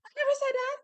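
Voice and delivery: high, fast, put-on voice of innocence; mock innocent denial. Bully girl denying the threat to Tyler, high mock-innocent. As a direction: play dumb in a squeaky high voice.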